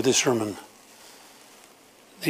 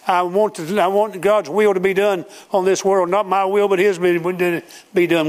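full-force preaching; 7 semitones higher than usual. Acted. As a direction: cry it out like the hour is late.

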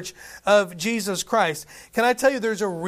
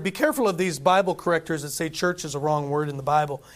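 normal talking voice

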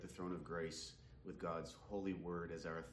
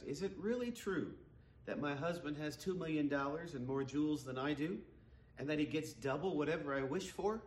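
higher, louder wondering question; woman's voice; 7 semitones higher, 3 dB louder than usual. Acted. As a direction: ask it disbelieving and pointed. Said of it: "she asked".

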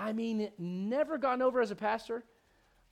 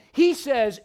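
ordinary delivery

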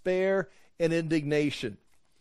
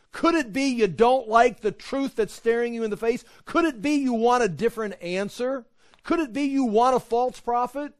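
higher-pitched, needling questions; pointed rhetorical questioning; 6 semitones higher, 3 dB louder than usual. Acted. Preacher challenging a generation that seeks a sign, pointed rhetorical questions. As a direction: press each question as if the answer is obvious.

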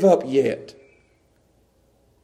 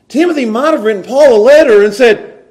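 preaching; 5 semitones higher, 8 dB louder than usual. Acted.

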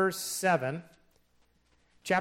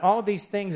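"all these things" leaned on, with "all" hit hardest and the phrase louder; stress on all these things; 5 semitones higher, 6 dB louder than usual. Shown as a demonstration. Preacher pointing out the emphatic words of the verse, stressed hard. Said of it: "is in the emphatic position".

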